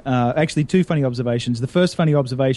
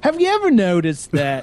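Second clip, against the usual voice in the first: a higher, lightly voiced Jerry Seinfeld opener; Seinfeld impression; 5 semitones higher than usual. Retaking the joke for the hosts, a light Seinfeld-style opener. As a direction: lean into the Seinfeld comic setup. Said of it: "this was a Jerry Seinfeld beginning joke".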